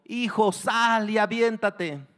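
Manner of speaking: slow, loud, high shout; drawn-out fatherly urging; urging the son. Acted. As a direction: shout to your child to jump. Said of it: "empezó a animar al hijo".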